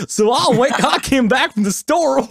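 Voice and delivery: high, loud hammed-up American accent; exaggerated American accent. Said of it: "did I change accents just now".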